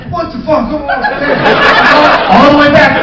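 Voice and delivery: high, loud, laughing, slow complaint; frantic camera guy. Retold or imitated. Camera operator griping while rushing back, loud and high. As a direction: scramble and gripe. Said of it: "the camera guy's going".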